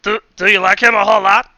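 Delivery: high, loud villain probing in mock Russian; hammed-up Russian villain question; wobbly Russian accent. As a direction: needle them in a bad Russian accent. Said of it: "what's going on with my accent".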